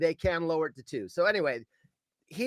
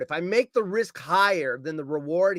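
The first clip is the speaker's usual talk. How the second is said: ordinary talk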